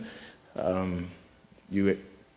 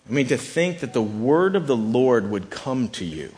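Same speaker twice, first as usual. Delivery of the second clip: normal talking voice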